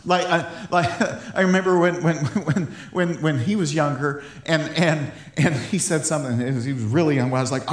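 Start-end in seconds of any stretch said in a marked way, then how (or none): none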